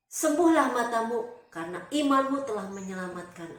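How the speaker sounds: loud, emphatic command; Jesus healing. A narrator's reading. Jesus tells Bartimius his faith saved him, commanding. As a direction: pronounce the healing with authority. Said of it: "Tuhan bilang seperti ini".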